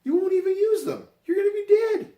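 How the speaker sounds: high, loud mock yelling at mom; teasing yell at dying mother about receipts; mock shout. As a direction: shout it up high, half joking. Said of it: "I'm like".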